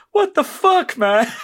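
high, loud cry of disbelief; outraged mock shout. Retelling the driver seeing his car explode, outraged mock shout. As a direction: yell it in hammed-up outrage.

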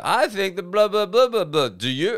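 lightly voiced American comedian asking the crowd a rhetorical question; mock comedian. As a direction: imitate a comic milking the crowd for a clap. Mimics a comedian fishing for claps.

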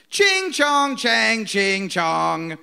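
very slow, high sing-song mock noises. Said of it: "just racist noises".

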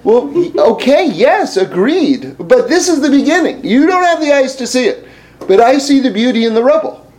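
high, animated mock rebuttal; acted insistent retort. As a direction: concede briskly, then push back hard.

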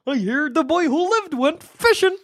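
high, hammed-up cartoon bear; exaggerated singsong bear impression; Yogi Bear. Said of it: "sounded heaps like Yogi Bear".

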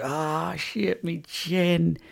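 high, slow, strained voice of a hurt cousin; pained groan. Retold or imitated. Cousin nursing his chin, a high slow pained moan. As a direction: moan it out like you just got headbutted. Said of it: "And he's like".

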